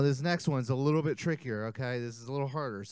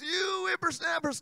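put-on old grandpa voice; 12 semitones higher than usual. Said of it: "Old Man Jenkins".

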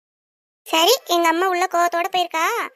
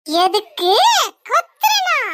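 high, loud outraged echo; indignant shriek; 5 semitones higher, 9 dB louder, much slower than usual. Acted. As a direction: throw his word back furiously. Wife indignant at husband. (கணவனிடம் உயர்ந்த குரலில் கோபமான எதிர்கேள்வி)

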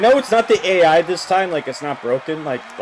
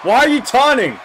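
loud, much higher, baffled yell; exasperated shout; 10 semitones higher, 6 dB louder than usual. Real, not acted. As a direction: yell the question in disbelief. Exasperated at wrestler taunting.

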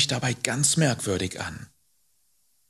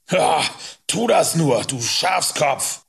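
loud, higher, derisive call; scornful calling; 5 semitones higher, 4 dB louder than usual. A narrator's reading. Rider calls after fleeing boy, scornfully. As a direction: call it out, stretching the insult. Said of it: "rief Hubert".

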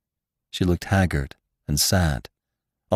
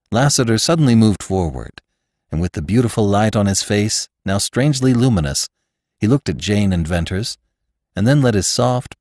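usual speaking voice

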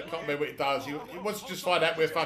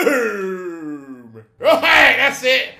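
shout; 3 semitones higher, 12 dB louder, much slower than usual. Real, not acted. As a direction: burst out on arrival. Arriving after fast-forward.

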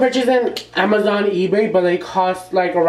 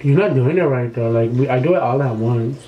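muffled by hand; speaking through fingers; hand over mouth; 4 semitones lower than usual. Real, not acted. Seen 0: Foreground man's hand covers nose and mouth peeling a strip; speaker unsure.

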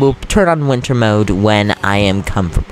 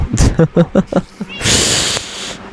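breathy high giggle; 7 semitones higher than usual. Real, not acted. High unvoiced laugh.

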